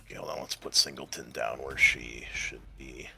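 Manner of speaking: low, quiet aside to yourself; low absent-minded murmur; muttering to self. Real, not acted. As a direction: murmur it under your breath while busy. Muttering to self while moving pieces.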